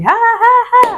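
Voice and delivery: high-pitched mock screams in a row; short, high, playful screams; imitated labor screaming. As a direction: act out cries of labor pain. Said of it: "I'm still screaming".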